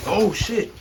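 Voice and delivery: high startled yelp. Real, not acted. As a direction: jump back in surprise. Snake strikes at him.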